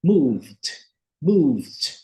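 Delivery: "moved" said /muvd/ with a soft voiced d; soft d ending. Shown as a demonstration. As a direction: end "moved" gently on d. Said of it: "pronunciar o E D com a letra D, bem suave". ("moved" com D final suave)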